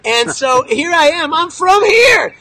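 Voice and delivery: building to a loud, drawn-out high shout; slow build, then belt out the last words; emphatic declaration. Retold or imitated. Guide retorts to the Australian journalist, defiant rising shout.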